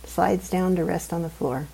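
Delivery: ordinary delivery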